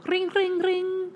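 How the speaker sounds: high-pitched ringing phone noise; imitated phone ring. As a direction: trill it high like a telephone. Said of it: "My phone goes".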